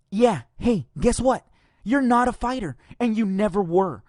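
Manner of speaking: high angry taunt; angry yell. Real, not acted. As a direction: yell it right at him. Angry rant at pundit.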